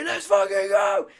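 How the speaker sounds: loud rallying shout; big celebratory team shout. Retold or imitated. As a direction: roar it to the boys. Rallying the team after win.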